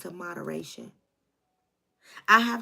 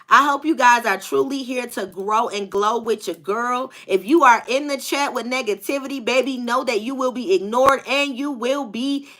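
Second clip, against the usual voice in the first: louder, upbeat livestream host; energetic tarot host rallying the chat; hype host; 3 semitones higher, 9 dB louder than usual. Acted. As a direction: pump the audience up to start. Host addresses chat and mods.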